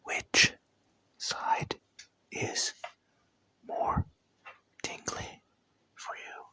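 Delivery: soft unvoiced ASMR whisper, close up. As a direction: whisper each word right into the ear.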